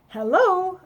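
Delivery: loud high hello into the house; bright called greeting; calling out. A narrator's reading. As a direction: call out to see if anyone's home.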